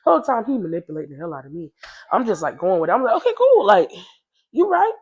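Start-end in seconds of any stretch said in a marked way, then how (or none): 3.2-4.9 higher, eager agreement; lightly voiced eager girl; younger self.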